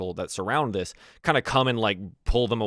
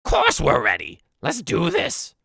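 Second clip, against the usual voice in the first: loud goblin battle-ready cry; rally the tribe in a high goblin shout; eager, yelling little goblin; 11 semitones higher, 9 dB louder, slower than usual. Acted. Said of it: "The little goblin".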